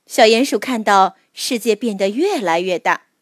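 ordinary delivery, nothing marked

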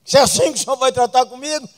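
loud, high complaining plea; loud, high exasperated plea to God; Moses' lament. Retold or imitated. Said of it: "Moisés fala assim com Deus".

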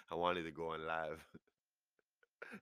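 usual speaking voice

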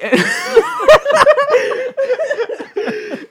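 high burst of real laughter; cracking up hard; loud laugh. Real, not acted. Friend laughs loudly recalling the horses. Friends retelling funny game story.

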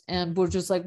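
usual speaking voice

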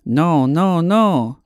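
a mock-alarmed waiter; servers' protest. A narrator's reading. The restaurant servers protest the alpacas, mildly alarmed. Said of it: "shouted the servers".

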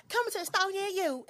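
high mock ad voice in a fake Southern accent; imitating fake accent. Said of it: "how she was sounding like".

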